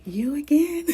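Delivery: high, loud amused disbelief; playful mock surprise at meeting him again; surprised "you again". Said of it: "our eyes were saying".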